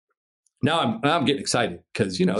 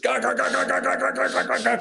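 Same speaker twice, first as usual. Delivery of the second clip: high, harsh chattering rotor noise; imitated helicopter roar; 11 semitones higher than usual. Helicopter arriving above crouched climber, high imitated rotor roar. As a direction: mimic blades hammering right overhead. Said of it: "fucking loudest thing that's ever been near".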